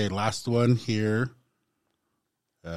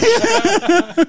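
July sung up high and loud; high-pitched echo; 7 dB louder than usual. Pretend. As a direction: squeal the word July. Echoing the perfume name.

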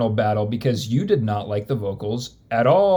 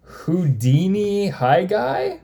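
Jawa voice; 6 semitones higher, slower than usual. Pretend. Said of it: "Those are Jawa noises".

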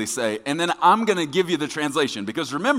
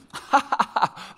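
mocking breathy laugh; 11 semitones higher than usual. Mocking laugh before translation.

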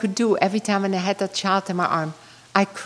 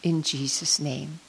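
hushed, lower, unhurried prayer with long pauses; closing prayer; 4 semitones lower, 4 dB quieter than usual. Real, not acted. Speaker closes her talk by praying to Jesus, hushed prayer. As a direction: pray softly and reverently, letting each phrase settle.

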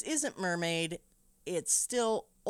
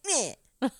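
loud high mock shrug sound; scornful meh; dismissive meh; 6 semitones higher, 5 dB louder than usual. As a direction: brush him off with a bored meh. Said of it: "He's just like".

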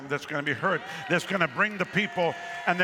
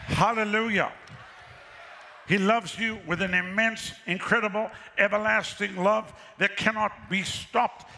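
higher and ringing, building preacher; preaching at full force; 5 semitones higher than usual. Real, not acted. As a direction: pour out the love to the crowd. Preacher rises to climax.

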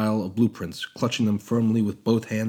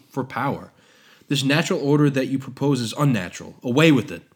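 louder, forceful denunciation; vehement protest; 4 semitones higher, 6 dB louder than usual. Acted. Prometheus denouncing Hephaestus's order, vehement protest. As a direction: hammer each charge home. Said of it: "protested vehemently".

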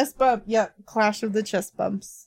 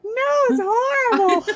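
mock wail; 13 semitones higher, 5 dB louder than usual. Mock wail over dark legend.

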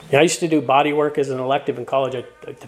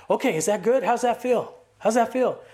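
higher, eager checking voice; eager husband; 5 semitones higher than usual. Retold or imitated. Dad asks his wife how the foot rub feels, imitated eagerly. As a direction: imitate a keen, oblivious helper.

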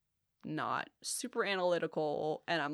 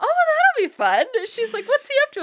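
much higher, louder curious mom; voicing her mom; 13 dB louder than usual. Mom asks about her old classmate, higher curious voice. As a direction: play mom, bright and nosy.

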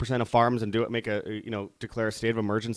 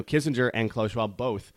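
usual speaking voice

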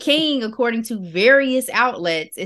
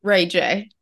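usual speaking voice

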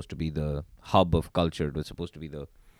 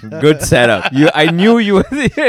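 delighted; 8 semitones higher, 13 dB louder than usual. Real, not acted. Friend nailed a pun.

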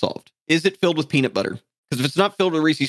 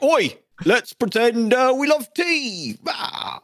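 higher, slow, hammed-up British accent; mock British accent; 8 semitones higher, much slower than usual. Mocking the English inventing tea, in a slow, high British accent. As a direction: ham up a scheming Brit with a British accent. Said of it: "My British impersonation".

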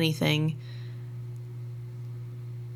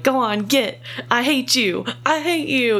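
louder, higher fake yell to drive the animal off; mock yelling; 6 semitones higher, 4 dB louder than usual. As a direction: yell it like you're chasing a horse away, only half meaning it. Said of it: "like, yelling at the animal".

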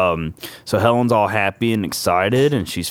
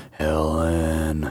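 low ominous call; 6 semitones lower, 5 dB quieter than usual. Retold or imitated. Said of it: "with his little".

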